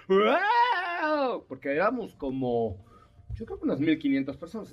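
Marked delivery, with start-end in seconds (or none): 0.1-1.4 amazed wow. Retold or imitated. Whole auction room reacts.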